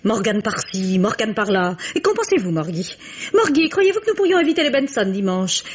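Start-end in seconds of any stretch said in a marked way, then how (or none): 2.0-5.6 put-on ape his fawning questions to the old housekeeper; mocking imitation of husband. Wife mimics husband deferring to housekeeper.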